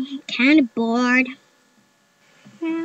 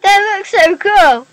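higher, louder, slow delight; excited; 7 semitones higher, 11 dB louder, slower than usual. Real, not acted. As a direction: gush over it slowly. Delighted at the design.

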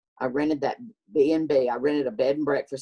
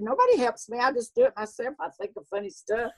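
much higher, insistent retort; high protest; 9 semitones higher, faster than usual. Retold or imitated. Comedian answering friends who suspect help, high-pitched protest. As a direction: protest innocently, pitch climbing to the end. Acting out own reply to friends.

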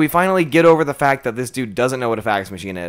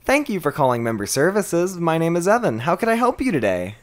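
higher, sing-song pandering agent voice; customer service voice; 6 semitones higher than usual. Shown as a demonstration. Agent greeting a caller in member services, pandering cheerful voice. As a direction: sweet-talk the caller like a call center pro. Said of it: "I don't appreciate this pandering tone".

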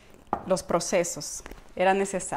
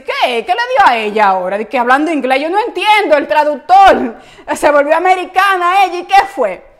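louder and higher, disbelieving; mock viewer shock; 5 semitones higher, 10 dB louder than usual. Viewer reacting to host speaking English, loud mock disbelief. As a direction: play a stunned viewer, loud. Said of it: "Tú vas a decir".

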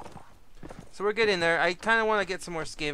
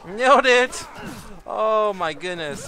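high, loud triumphant yell; excited shout; 6 semitones higher, 12 dB louder, slower than usual. Real, not acted. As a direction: shout it out like you just scored. Lucky axe hit in game.

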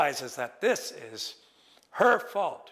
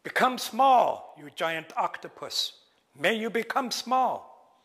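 magic command; 4 semitones higher than usual. Acted. Raven shrinks the octopus, booming slow spell. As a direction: cast the spell at the giant octopus. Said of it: "And he's like".

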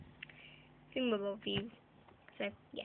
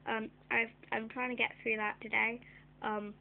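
ordinary talk, nothing marked

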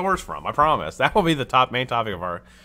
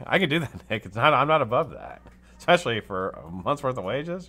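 ordinary delivery

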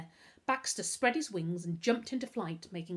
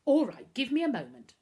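lightly sulky, louder and higher; pouting; 6 semitones higher, 9 dB louder than usual. A narrator's reading. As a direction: give in with a pout. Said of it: "Novak pouted".

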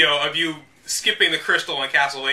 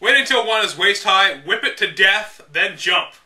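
measured, deliberate strategy-guide narration; guidebook read-aloud; 3 semitones higher, 3 dB louder than usual. Acted. Reading old game guide to viewers, deliberate. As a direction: read it like the official manual, step by step.

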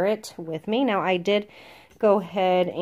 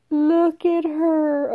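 cooing; 8 semitones higher, 4 dB louder, much slower than usual. Real, not acted. Cooing over the mouse.

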